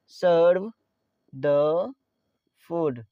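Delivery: "Serve", pause, "the", pause, "food"; word by word. Shown as a demonstration. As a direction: dictate "Serve the food" in isolated words, stepping down. Teacher repeats phrase word by word. ("Serve the food" एक-एक शब्द रुक-रुककर)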